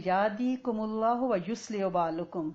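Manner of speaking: یادیکم اللہ و یصلح بالکم recited slowly in Arabic style; slow Arabic recitation. Shown as a demonstration. Teacher offers another reply, recited slowly. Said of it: "یہ بھی کہہ سکتے ہیں".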